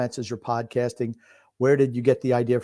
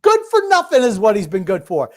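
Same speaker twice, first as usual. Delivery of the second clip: scornful; 6 semitones higher, 6 dB louder than usual. Real, not acted. Mocking a struggling player.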